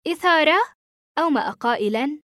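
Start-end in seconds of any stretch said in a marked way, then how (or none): 0.1-0.6 higher, louder startled question; taken aback, voice jumping up; astonished. A narrator's reading. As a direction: echo it back in astonishment. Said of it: "تساءلت في دهشة".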